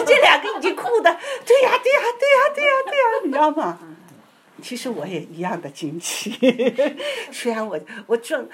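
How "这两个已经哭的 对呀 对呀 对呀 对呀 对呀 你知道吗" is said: very high, loud, laughing; telling the listener, laughing and thrilled; laughing excitement. Real, not acted. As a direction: giggle through the yes-yeses.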